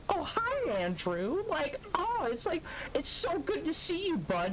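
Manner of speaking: high, sing-song mocking voice of the old coworker; sugary fake-friendly hello, hammed up; mock cheerful greeting. Imitating the old coworker greeting him, mock cheerful and high. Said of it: "He's like".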